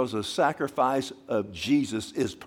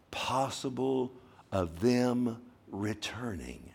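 slow solemn; much slower than usual. Real, not acted. Preacher to congregation, slow and solemn. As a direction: let each word sink in.

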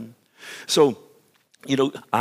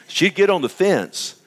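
usual speaking voice

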